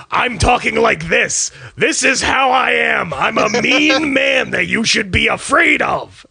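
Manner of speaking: mock bad-guy villain voice; hammed-up TV villain, announcing his menace; Doctor Cox. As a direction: put on an exaggerated mean-man delivery. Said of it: "the bad guy villain thing, like, uh, like Doctor Cox".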